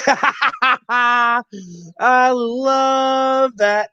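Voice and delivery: high-pitched laughing delight; helpless laugh into a high, stretched phrase; real laughing. Real, not acted. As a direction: laugh hard, then draw the words out high. Cracks up at the other's remark.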